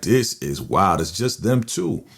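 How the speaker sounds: ordinary talk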